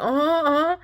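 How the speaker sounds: high, loud wordless agreement; mouth full of water. Retold or imitated. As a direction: imitate answering through a mouthful of water. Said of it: "hold water in my mouth".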